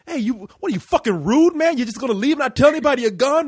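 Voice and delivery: high, loud mock scolding; imitating angry friend. Friend's angry phone call retold.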